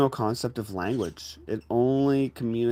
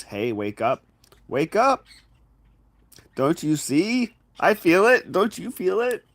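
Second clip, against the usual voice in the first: high, rising urgent pleas, building louder; imitated screaming plea; 9 semitones higher, 5 dB louder than usual. Someone pleading with you to wake up, urgent and high. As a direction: plead to be understood, pitch climbing on each question. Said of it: "that's screaming in your face".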